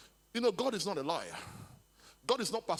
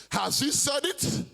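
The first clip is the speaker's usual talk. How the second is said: rising sung preacher's cry, louder; preaching shout; 4 semitones higher, 4 dB louder than usual. Real, not acted. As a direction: belt the question out to the church. Preacher's rhetorical climax.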